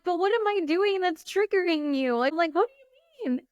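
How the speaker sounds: high-pitched, baffled questioning; shocked protest. Retold or imitated. Coach replaying her reaction to triggered followers, high baffled protest. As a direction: protest in high, puzzled disbelief. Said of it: "I'm still kinda shocked".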